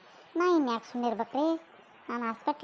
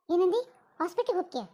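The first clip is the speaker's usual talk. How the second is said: higher, louder startled demand; shocked outburst; 6 semitones higher, 4 dB louder than usual. Acted. Mother-in-law hearing the hospital plan, shocked outburst. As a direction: snap back in alarm at her words.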